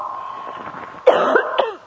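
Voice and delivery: harsh coughing fit, voice catching; dry cough. Real, not acted. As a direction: cough it out, struggling for air. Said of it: "I need some water".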